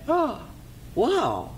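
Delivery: high, drawn-out awe; gasp, then marvel as the king; impressed king. Acted.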